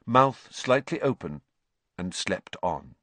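ordinary delivery

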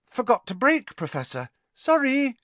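light, high, breezy excuse; blithe. A narrator's reading. Girl excusing her crash to an irate professor, blithely. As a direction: toss off an airy apology. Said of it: "Harry heard Ginny saying blithely".

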